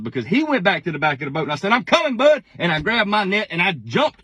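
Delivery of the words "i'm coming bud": excited call. Retold or imitated. Calling out to dad mid-catch.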